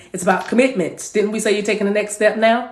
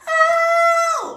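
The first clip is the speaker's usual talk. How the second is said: very high sung ad-lib cry; high falsetto whoop. Pretend. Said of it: "Remember you sang that?".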